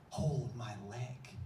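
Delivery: very slow, low, drawn-out ask; slow calm request. Retold or imitated. Jim Carrey to Brent on the ledge, slow and low. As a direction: stretch each word, cool and unhurried.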